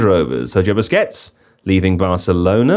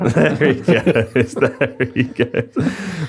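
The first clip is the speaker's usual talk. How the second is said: laughing; 5 semitones higher, much slower than usual. Real, not acted. Laughing at guest's arrival.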